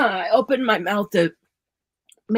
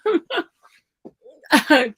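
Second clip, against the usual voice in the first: laughing; 6 semitones higher than usual. Real, not acted. Laughs after choking.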